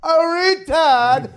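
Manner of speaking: very high, stretched-out cry; mock greeting. Comic greets Gary each time he called, high mocking cry. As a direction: squeal it out long as a caricature. Imitates his old call-in greeting.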